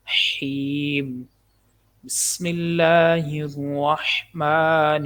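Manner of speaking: slow, careful tajweed recitation; correct recitation. Shown as a demonstration. Teacher reciting the whole phrase correctly, slow. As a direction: recite it slowly and precisely. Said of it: "لفظ اللہ کو باریک پڑھنا".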